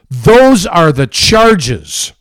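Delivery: "those are the charges" dragged out slow, leaning on "those"; slow emphatic stress. Acted. Radio host hammering Christianity Today, slow heavy stress. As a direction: spell out "those" hard and slow.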